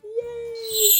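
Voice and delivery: plain reading voice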